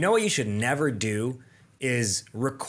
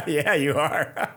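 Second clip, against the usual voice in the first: laughing; 4 semitones higher, slower than usual. Real, not acted. Laughing at the astrology question.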